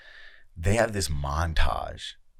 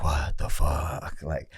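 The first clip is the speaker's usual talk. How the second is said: slow, drawn-out "What the fuck?" in disbelief; stunned disbelief; 4 semitones lower, much slower than usual. Retold or imitated. Friend recalling his reaction to the montage, drawn out in shock. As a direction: let it hang, truly stunned.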